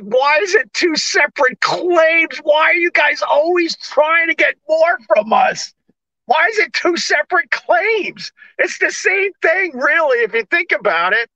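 high, whiny mock outrage; mock protest. Customer arguing with claims guy, high whining protest.

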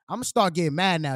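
ordinary talk, nothing marked

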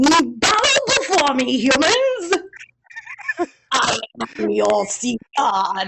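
child playing a sea god; bossy kid shouting commands; megalomaniac rant. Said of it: "five-year-old ... going".

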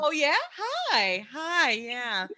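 high, bright phone-greeting voice; sing-song chirpy customer service hello. Shown as a demonstration. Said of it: "six octaves higher than what we're".

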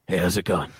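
very low, terse greeting; Mickey Rourke. Retold or imitated. Smoking star greets the boys, very low voice. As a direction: drop way down for an offhand hello. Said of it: "and he goes".

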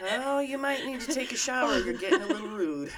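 put-on Canadian politeness through laughter; mock Canadian accent. Co-host politely suggesting a shower, laughing mock Canadian accent. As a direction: copy the bad Canadian accent, giggling. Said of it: "I'm gonna start saying that".

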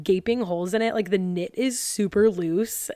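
normal talking voice

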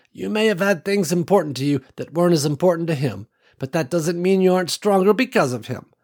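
lightly voiced older woman, gently insistent; kindly old woman. Ruby telling Nicole the others need her, lightly voiced kindly old woman. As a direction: reassure her like a fond grandmother.

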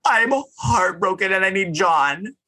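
high-pitched imitation of a heartbroken wail; mock sobbing. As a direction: play up her tearful plea, without real crying. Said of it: "Lisa's sobbing".

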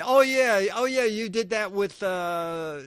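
eager fan recognition. Retold or imitated. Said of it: "you say".

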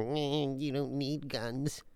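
soft, high disapproving mock quote; imitating Megan. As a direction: mimic a prim scold. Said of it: "Megan is all like".

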